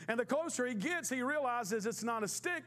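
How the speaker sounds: usual speaking voice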